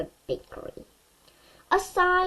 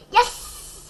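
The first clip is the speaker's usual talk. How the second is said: put-on delighted kid; 13 semitones higher, 5 dB louder than usual. A narrator's reading. Boy delighted at dinosaur.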